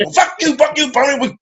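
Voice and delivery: yelling fuck you back down the phone; shouted fuck yous. Retold or imitated. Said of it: "I said".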